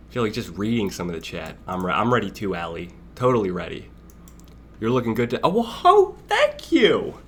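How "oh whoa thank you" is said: high, startled whoa and then thanks; surprised thanks. Real, not acted. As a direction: caught off guard by a compliment. Surprised by a chat compliment.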